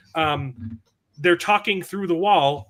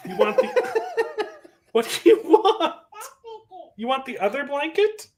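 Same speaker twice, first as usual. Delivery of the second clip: high, laughing voice for his little daughter; 14 semitones higher than usual. Real, not acted. Talking to his small daughter.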